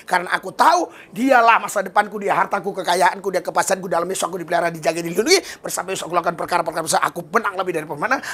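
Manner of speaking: quick, steady declaration of faith; fast confident prayer. Shown as a demonstration. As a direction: rattle it off with no room for other thoughts. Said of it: "Tengok saya berdoa". (doa cepat dan yakin)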